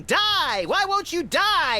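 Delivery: mock screaming.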